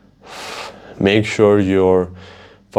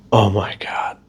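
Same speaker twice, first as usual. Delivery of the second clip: wincing whisper. Real, not acted. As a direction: whisper it cringing. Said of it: "I'm still wincing".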